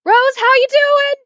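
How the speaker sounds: high, sung-out greeting; character voice. Acted. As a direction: call out brightly to a friend. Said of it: "she would be like".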